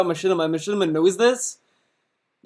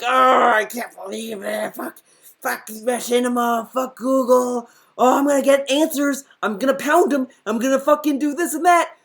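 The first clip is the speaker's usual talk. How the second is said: whiny, higher mock rant; mock tantrum; 4 semitones higher than usual. Commentator mimics streamer when things don't go his way, mock tantrum. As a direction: imitate a grown man throwing a child's fit. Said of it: "a fucking child. When things doesn't go his way, he's like".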